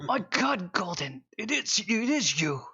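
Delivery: lightly voiced old miner, surprised and glad; a touch of a delighted old man. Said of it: "And he just goes".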